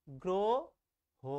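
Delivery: গ্রহ very slowly, split as gro... ho; pronounced with o-kar. Shown as a demonstration. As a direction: stretch the o in গ্রহ, pause before the last syllable. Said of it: "একটা ওকার দিয়ে উচ্চারণ করো".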